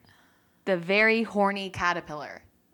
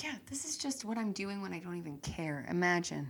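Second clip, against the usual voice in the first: muttered aside; 8 dB quieter than usual. Retold or imitated. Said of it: "I'm like".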